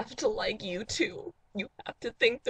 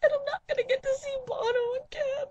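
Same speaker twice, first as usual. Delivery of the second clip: high wailing cry, words pushed out fast; hammed-up sobbing; faster than usual. Dating video host wailing over lost U2 tickets, hammed-up sobbing. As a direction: bawl it like a heartbroken fan.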